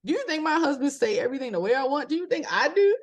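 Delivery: high, fast and heated; worked-up high voice. Real, not acted. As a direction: pitch climbing as she gets fired up. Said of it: "you hear how I sound now?".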